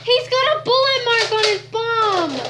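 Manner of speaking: high, loud call for a medic; man down shout. Pretend. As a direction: yell for a medic in playful alarm. Calls for medic, playful.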